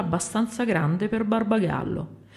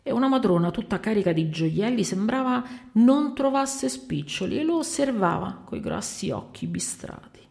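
ordinary delivery, nothing marked

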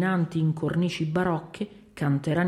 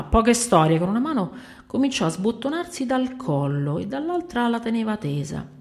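ordinary delivery, nothing marked

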